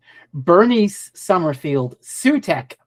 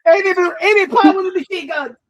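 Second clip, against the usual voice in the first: excited; 12 semitones higher, 3 dB louder than usual. Real, not acted. Cheering the machine gun scene.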